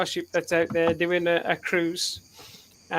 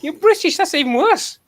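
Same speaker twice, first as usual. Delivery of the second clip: high, loud mock disgust; retold TV character; 11 semitones higher, 8 dB louder than usual. Friend retelling a comedy scene, disgusted crew member. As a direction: pitch it up and sneer the punchline. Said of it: "He said".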